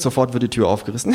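ordinary delivery, nothing marked

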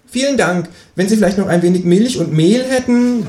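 a cheeky kangaroo asking for eggs. Acted. Kangaroo voiced in read story. (das Känguru bittet um Eier, mit hoher Stimme)

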